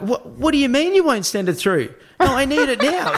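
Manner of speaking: high-pitched, indignant phone protest; mock argument. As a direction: play-act arguing down the phone. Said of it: "like a mock argument".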